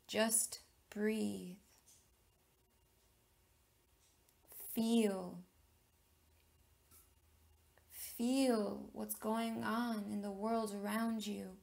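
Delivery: measured meditation leader, long pauses between phrases; deliberate, spacious meditation delivery; guided meditation. Real, not acted. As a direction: guide listeners inward, phrase by phrase, letting key words stretch.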